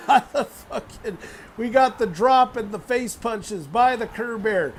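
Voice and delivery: normal talking voice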